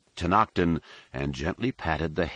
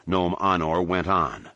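ordinary delivery

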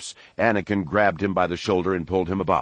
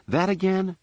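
high-pitched incredulous question; higher-voiced younger brother; younger brother's protest; 13 semitones higher than usual. A narrator's reading. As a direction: pitch it well up, balking at the lecture. Younger brother balks at familiar lecture.

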